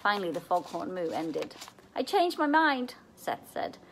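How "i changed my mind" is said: high, slow, frightened retreat; a boy losing his nerve; scared backing out. A narrator's reading. Seth startled by the mooing cow, backing out.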